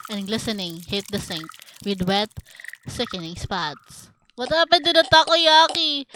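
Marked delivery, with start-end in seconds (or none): 4.3-6.0 high, loud outburst; mock alarm. Real, not acted. Streamer breaks off a gross horror reading, loud mock alarm. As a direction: blurt it out in high-pitched shock.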